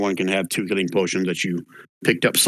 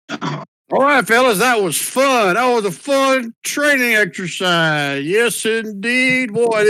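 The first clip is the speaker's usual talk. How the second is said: Rendell's showy cover voice; 9 semitones higher than usual. Acted.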